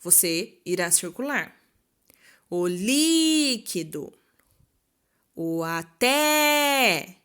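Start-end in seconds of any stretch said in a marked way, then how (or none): 2.5-4.1 "líquido" called out with LÍ held long; slow "líquido", all weight on LÍ; calling out stressed syllable. Shown as a demonstration. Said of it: "quando eu chamo a palavra". 5.3-7.1 "até" called out with final TÉ held long; very slow "até", all weight on TÉ; calling out stressed syllable. Shown as a demonstration. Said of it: "quando eu chamo a palavra".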